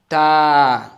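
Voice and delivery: Ta said loud with a full, rounded mouth. Shown as a demonstration. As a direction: model heavy Ta, not thin. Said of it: "Don't say ta in empty mouth".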